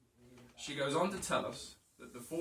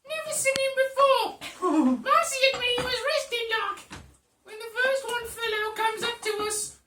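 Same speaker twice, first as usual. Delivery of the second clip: high, loud Cockney woman, laughing through it; squeaky Cockney barmaid telling her tale; Cockney lady accent; 9 dB louder than usual. Acted. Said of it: "brilliant Cockney lady accent".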